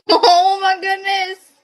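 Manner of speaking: high, slow laughing oh my goodness; delighted laugh. Real, not acted. Seeing the duck pictures, laughing with delight. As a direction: melt with delight at the photo.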